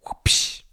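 unvoiced mouth-made whoosh; quick airy swoosh effect; imitated whoosh. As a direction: puff out a cartoon whoosh.

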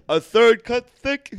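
slow, high, loud deli order; drawn-out deli man question; deli guy. Acted. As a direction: ask it across the deli counter. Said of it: "He looks like a deli guy".

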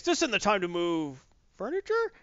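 lightly voiced gruff ghost dog growling; a hint of a grumbling old guard dog; grumpy ghost dog. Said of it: "you see him saying".

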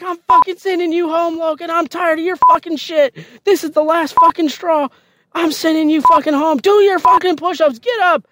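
very high-pitched, furious tirade; angry drill sergeant yelling. Retold or imitated. Drill sergeant threatening to send recruit home, angry yelling. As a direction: bark orders like a fed-up drill sergeant. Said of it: "she's angry, like, all the fucking time".

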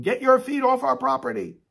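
high, loud retold scream; angry yelling. Abortion mill worker screams at praying protesters, yelling angrily. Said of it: "came out yelling and screaming".

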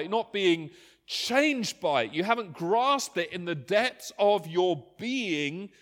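loud, high, insistent preaching; full-force preaching. Acted. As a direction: drive it home like a pulpit appeal. Impassioned preaching, Paul's voice.

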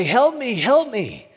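high, loud cry for help; calling for help. Retold or imitated. Drowning person calling out to the sailor in his boat. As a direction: yell to the boat from the water. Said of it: "you call out to him".

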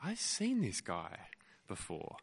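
slow, hushed inner voice; musing to oneself. Acted. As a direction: muse quietly, as if just recognising someone. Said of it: "you think to yourself".

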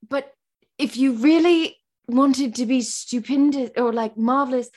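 imitating a Brit, slightly rusty British accent. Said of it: "my British accent is crap now".

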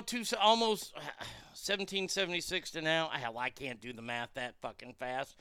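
usual speaking voice

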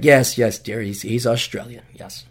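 low, humouring mock agreement; resigned indulgence. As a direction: placate a partner, dropping away at the end. Resigned answer to girlfriend, retold.

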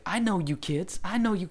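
high-pitched mock scolding; imitated yelling. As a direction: call out the kids like an angry adult. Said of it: "He's, like, yelling at these kids".

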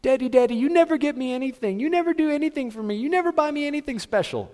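put-on high, childish nagging voice; whiny child. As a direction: whine like a kid begging dad. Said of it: "If your children came to you".

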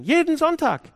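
high, loud call on Jeden; emphatic cry. Acted. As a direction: cry it out as the peak of the build. Climactic exclamation in sermon.

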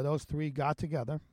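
usual speaking voice

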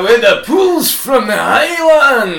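slow, loud, hammed-up Highland Scottish; mock a bad Scottish burr; terrible Scottish accent. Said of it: "it's a terrible Scottish accent".

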